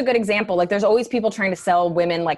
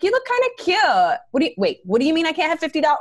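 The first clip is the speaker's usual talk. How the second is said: mock sweet then shocked; 7 semitones higher than usual. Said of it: "everybody else is just like".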